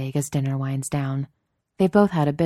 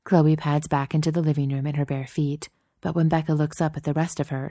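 ordinary delivery

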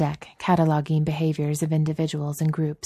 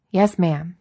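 confident; 2 semitones higher, 4 dB louder than usual. A narrator's reading. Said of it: "with significantly more confidence".

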